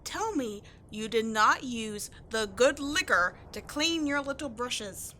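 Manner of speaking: usual speaking voice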